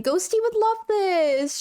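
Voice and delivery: high, slow, gushing squeal; excited squeal. Real, not acted. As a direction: squeal it out in delight. Excited about the Oogie shirt.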